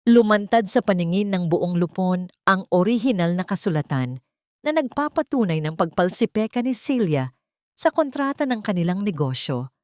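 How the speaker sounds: plain reading voice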